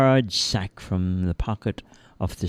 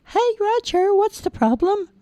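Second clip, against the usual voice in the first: very high squeaky little voice; chirpy tiny elf; tiny man voice. Acted. Tiny man greets crying Roger, squeaky high.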